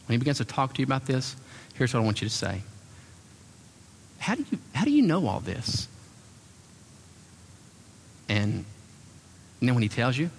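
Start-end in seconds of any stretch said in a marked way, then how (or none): 4.2-5.9 admiring wonder. Shown as a demonstration. Coaching wife's admiring line.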